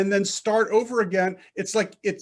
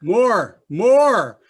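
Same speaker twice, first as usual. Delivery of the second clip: louder, pushing more; louder coaching; 7 dB louder than usual. Real, not acted. Teacher urging Barbara to push the sound harder, louder. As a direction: urge the student on. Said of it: "coaching a student louder".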